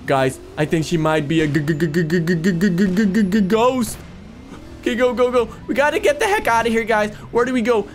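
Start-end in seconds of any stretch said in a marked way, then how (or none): 1.5-4.0 long cartoonish stammer climbing to the word; hammed-up fearful stammering; mock scared stutter. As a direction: stutter the g over and over before it bursts out. Mock fear at seeing a ghost.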